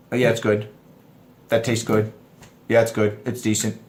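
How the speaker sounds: low-pitched, unimpressed man; not overexcited. Retold or imitated. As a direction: concede it with no excitement at all. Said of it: "We don't get overexcited".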